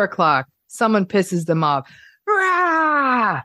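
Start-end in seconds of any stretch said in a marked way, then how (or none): 2.3-3.4 much higher, short mock rage roar; a hammed-up blast of temper; mock angry roar. Provoked narcissist erupting, mock angry roar.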